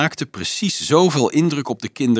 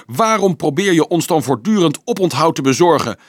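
cutting; 7 semitones higher, 4 dB louder than usual. A narrator's reading. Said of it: "viel Anselmus snijdend in".

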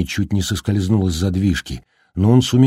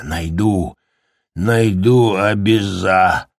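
lightly voiced rambling, slow and broken; a hint of a man losing his mind; rambling madman; slower than usual. A narrator's reading. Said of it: "речь снова стала бессвязной".

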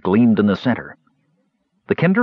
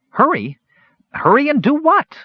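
very high, panicky questioning; high-pitched, flustered exclamation; frantic cry; 4 dB louder than usual. A narrator's reading. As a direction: throw it back in a panic. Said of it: "Earwig cried frantically".

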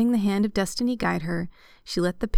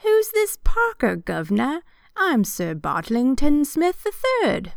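high, loud, slow mock-British voice; put-on British accent; 8 semitones higher, 5 dB louder, slower than usual. A narrator's reading. Parker stays in character when challenged, hammed-up British accent. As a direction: ham up a grand British character. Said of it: "is that your British accent?".

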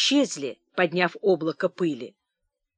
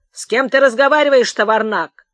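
loud, raised demand; angry shout; 4 semitones higher, 7 dB louder than usual. A narrator's reading. Clerk knocking off the old man's hat, shouting angrily. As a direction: bellow it at the old man.

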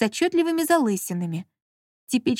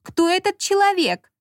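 much higher and louder, irritated; shrill, irritated; 10 semitones higher, 4 dB louder than usual. A narrator's reading. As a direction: squeal it out in jealous irritation. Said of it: "визгливым, раздражённым голосом". (визгливо и раздражённо, намного выше и громче)

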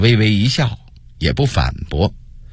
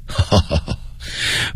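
low, airy laugh; knowing chuckle; 5 semitones lower, 5 dB quieter than usual. Acted. Monk amused by the prince's stammered question, chuckling. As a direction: chuckle softly to yourself.

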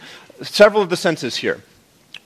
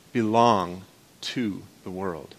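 hushed emphasis; 9 semitones lower, 8 dB quieter, slower than usual. Acted. Sermon emphasis, softening.

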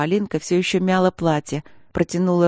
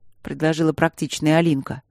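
usual speaking voice

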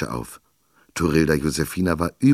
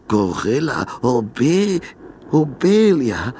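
slow, higher, louder stammering as fear rises; terrified stammer; 7 semitones higher, 5 dB louder, much slower than usual. A narrator's reading. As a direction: choke on the name in fright. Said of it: "stotterte er zwischen klappernden Zähnen".

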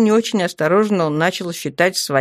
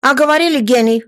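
high, louder scoff; scornful snort; 7 semitones higher, 5 dB louder, faster than usual. A narrator's reading. Guardsman mocks the gnome genius, scoffing. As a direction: scoff at him, pitched up and loud. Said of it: "фыркнул кто-то из гвардейцев".

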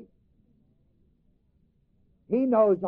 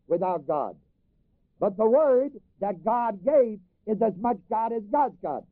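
usual speaking voice

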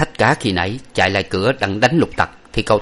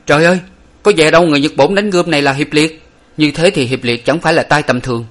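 higher, louder shocked cry; startled exclamation; 6 semitones higher, 4 dB louder than usual. A narrator's reading. Examiner startled by the mark on the wound, loud and high. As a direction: gasp out the realisation. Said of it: "giật mình nói lớn lên rằng".

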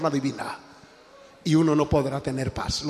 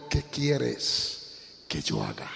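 a touch of a trembling whisper, slow and soft; mild fearful plea, much softer and lower; 6 semitones lower, 8 dB quieter, much slower than usual. Retold or imitated. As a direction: surrender in a small, frightened voice. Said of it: "él temblando, muy temeroso".

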